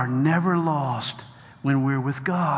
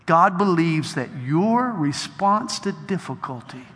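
normal talking voice

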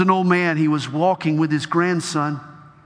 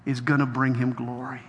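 ordinary talk, nothing marked

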